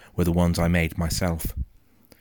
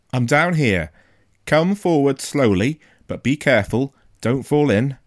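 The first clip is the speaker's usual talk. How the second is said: raised, careful warning; calling up; 7 semitones higher, 6 dB louder than usual. A narrator's reading. Father in a pit guiding his son, calling up. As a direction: call up from below with caution.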